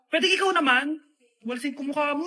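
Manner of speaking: ordinary talk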